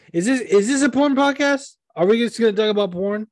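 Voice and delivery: high, fast mock indignation; mock outrage. Real, not acted. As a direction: act scandalized. Mock outrage at porn joke.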